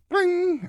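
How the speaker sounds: high squeaky ring sound; phone ring. Retold or imitated. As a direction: chirp it like an old phone. Imitating the phone ringing.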